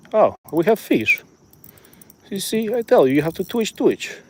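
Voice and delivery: lightly hammed Russian fisherman teaching; a hint of Russian accent; mock Russian accent. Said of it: "stop the Russian accent".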